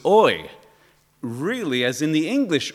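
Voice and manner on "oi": loud high oi; a loud high gut "oi"; Hebrew oi. Shown as a demonstration. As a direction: belt out the Hebrew oi. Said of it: "in Hebrew, it's".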